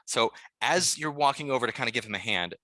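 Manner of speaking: ordinary talk, nothing marked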